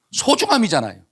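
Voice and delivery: ordinary talk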